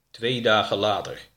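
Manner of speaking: ordinary delivery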